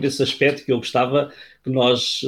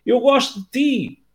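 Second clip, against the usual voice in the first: shouted call; 9 semitones higher than usual. Acted. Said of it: "o pai gritou em direção ao interior da gruta".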